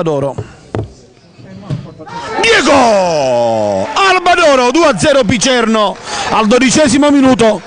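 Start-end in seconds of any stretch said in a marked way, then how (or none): 2.4-7.6 scorer's name yelled high; excited goal call. Real, not acted. Second goal, name shouted. (annuncio del gol esultante)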